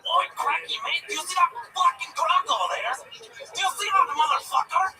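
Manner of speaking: high, excited Australian impression; Australian accent. Shown as a demonstration. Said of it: "let's hear your Australian impression".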